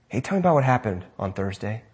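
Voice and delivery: low, soft parent's question; gentle prompting. Acted. Parent asking a child about the experience, gentle prompting. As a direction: ask softly, inviting the child to share.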